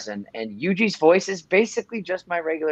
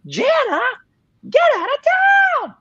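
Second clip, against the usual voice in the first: shrill, much higher screaming yell; 7 dB louder than usual. Retold or imitated. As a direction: scream it at the top of your range. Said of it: "higher and higher pitched until I was basically screaming".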